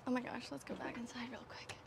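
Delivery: hushed whisper, barely voiced. Real, not acted. Whispering to the viewers to go back inside. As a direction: drop to a secret whisper.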